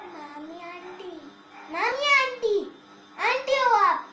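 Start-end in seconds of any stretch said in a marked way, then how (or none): 1.7-4.1 higher, louder taunt; loud teasing. Real, not acted. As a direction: call it out to tease her. Child teasing aunt loudly.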